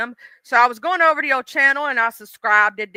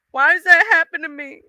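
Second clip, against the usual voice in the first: usual speaking voice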